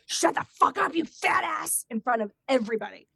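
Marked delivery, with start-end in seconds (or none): 0.0-1.9 loud, raised snarl imitating her; hammed-up exorcism voice, forceful; possessed angry voice. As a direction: imitate her sudden demonic outburst. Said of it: "deep voice".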